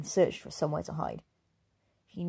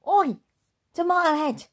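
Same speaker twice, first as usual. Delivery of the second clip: much higher and louder, jeering call; rude shout; 11 semitones higher, 8 dB louder than usual. Acted. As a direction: yell at the wolf to mock him. Girl calls out rudely.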